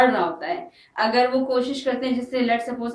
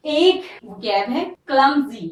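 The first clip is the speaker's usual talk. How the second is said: ordinary talk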